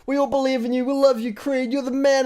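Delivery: high, gushing encouragement; Rocky's corner gushing at Creed, pitched high; gushing corner pep talk. Retold or imitated. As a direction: cheer him on like a devoted coach. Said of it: "he's like".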